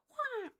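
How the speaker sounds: high, hushed imitation eagle screeches; soft eagle cry. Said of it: "That's the eagle".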